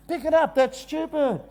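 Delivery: high-pitched, insistent sibling order; bossy big brother. Retold or imitated. Big brother ordering little brother in a squabble, high and bossy.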